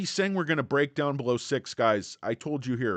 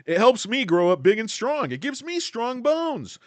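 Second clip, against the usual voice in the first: put-on high, louder kid-commercial voice, slowing down; mock wholesome ad voice; 11 semitones higher, 7 dB louder than usual. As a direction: sell tap water like a cereal ad kid. Mock ad after drinking water.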